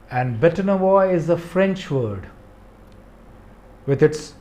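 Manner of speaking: normal talking voice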